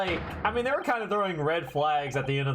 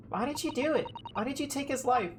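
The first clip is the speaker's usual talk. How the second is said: put-on a young woman asking why he took a life; high hurt accusing girl; 9 semitones higher, faster than usual. Girl confronts the accused, high and wounded.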